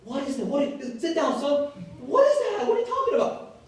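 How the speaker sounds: dad brushing off his son, high and incredulous; puzzled dad. Retold or imitated. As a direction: act out the father who has no idea what this is. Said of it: "And my dad says".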